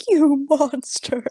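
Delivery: mock outrage. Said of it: "he really would be like".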